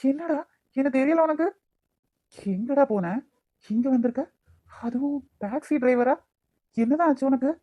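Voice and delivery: can't believe it's him; shocked disbelief. Acted. Stunned at meeting lost friend Aadhi.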